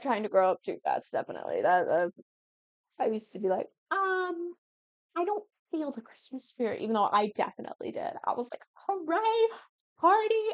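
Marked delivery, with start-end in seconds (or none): 3.9-6.8 higher-pitched, put-on indifference; hammed-up teenage reluctance; mock too-cool teen. Mimics her younger too-cool self. 8.7-10.4 high-pitched put-on hooray; imitating hollow enthusiasm; mock cheer. Mimics her past self cheering.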